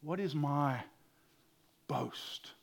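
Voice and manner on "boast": ordinary talk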